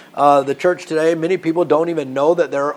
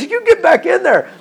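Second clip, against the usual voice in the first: very high, louder comic command; bossy order pitched way up through a laugh; laughing mock order; 14 semitones higher, 5 dB louder than usual. Preacher as Paul sends Eutychus back inside, laughing mock order.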